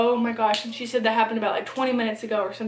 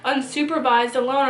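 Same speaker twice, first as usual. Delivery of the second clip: usual speaking voice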